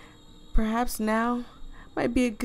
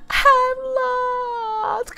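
high, loud hammed-up wail; mock crying; 5 dB louder than usual. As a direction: wail it like a crybaby, overdone.

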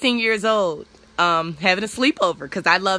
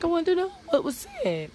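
mom's answer pitched much higher; lightly voiced mom, higher; imitating mom; 12 semitones higher than usual. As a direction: mimic mom, a touch innocent. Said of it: "She was like".

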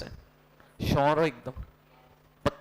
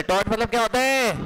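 higher, louder quiz call to the class; insistent repeat question; 4 semitones higher, 4 dB louder than usual. Real, not acted. Teacher asks the class once more what tort means, insistent. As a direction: push the question out to rouse an answer. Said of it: "फिर से तो".

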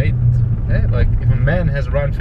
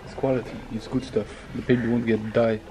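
put-on French accent; 7 semitones higher, 9 dB quieter than usual. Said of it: "still speaking in a French accent".

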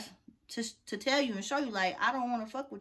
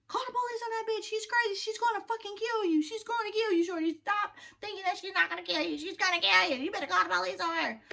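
higher, pushy warning voice; urgent partner; 11 semitones higher than usual. Retold or imitated. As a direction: urge her hard to call the police, much higher. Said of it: "she was like".

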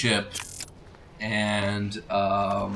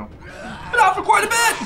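excited. Real, not acted. Surprised how long the game's been out.

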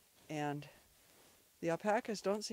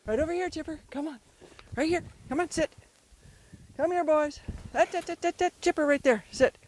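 loud, high, repeated dog commands; urgent commands; 8 semitones higher, 10 dB louder than usual. Real, not acted. Owner getting dog to sit in place, urgent commands. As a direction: call the dog into place and correct it.

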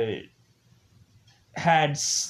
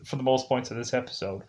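usual speaking voice

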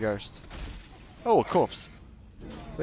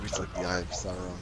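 usual speaking voice

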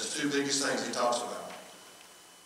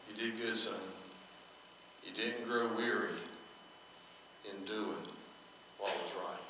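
inner voice; 3 semitones lower, 12 dB quieter than usual. Retold or imitated. Inner voice of God heard.

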